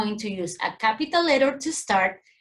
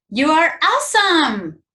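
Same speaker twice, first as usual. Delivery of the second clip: higher and louder, full of delight; excited exclamation; 6 semitones higher, 7 dB louder than usual. Shown as a demonstration. Teacher acting out an exclamation for students, excited. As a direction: cheer it like a proud teacher. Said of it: "These sentences express emotions".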